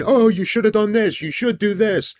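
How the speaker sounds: high, hammed-up yell at the screen; mock the frustrated viewer yelling advice; mock screaming at screen. Viewers yelling advice at the screen, mock high shout. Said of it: "screaming you do at the screen".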